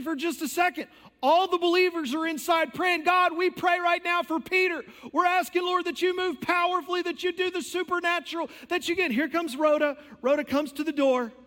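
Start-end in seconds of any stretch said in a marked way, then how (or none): 3.0-9.1 higher, urgent prayer voice, lightly acted; mock fervent prayer. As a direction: pray like the gathered believers. Voicing believers praying.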